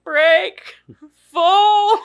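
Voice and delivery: high, loud hollered echo; Sloth. Retold or imitated. Echoing the lullaby's last words, loud mock holler. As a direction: shout single words like a big excited simpleton. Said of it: "And he's like".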